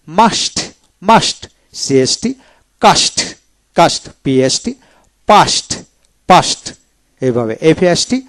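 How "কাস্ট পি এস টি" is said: usual speaking voice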